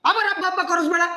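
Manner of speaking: high, exasperated scolding; angry rebuke. Acted. Father-in-law fed up with being called father, scolding high. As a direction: cut him off with a high-pitched rebuke. Cutting off son-in-law in quarrel.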